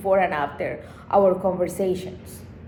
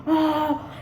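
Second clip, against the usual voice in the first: gasp; 9 semitones higher than usual. Real, not acted. Shocked recalling his behaviour.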